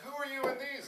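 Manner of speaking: mock curious teenager; imitated eager student. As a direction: pipe up high, suddenly interested. Imitating newly interested students.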